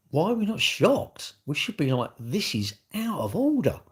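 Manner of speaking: outraged appeal; indignant protest. Real, not acted. Protesting that people should be shocked.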